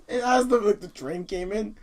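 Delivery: usual speaking voice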